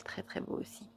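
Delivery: breathy hushed whisper of awe. Real, not acted. Admiring the Raziel card to viewers, whispered.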